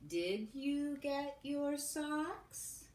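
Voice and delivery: very slow, every word spaced out; slow dictation. Shown as a demonstration. Teacher dictating the sentence while kids write on paper, very slow. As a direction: dictate it gently for the children to write. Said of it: "You try to write it on your paper".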